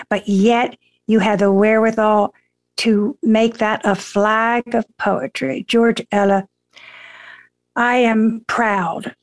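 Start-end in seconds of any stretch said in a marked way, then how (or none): none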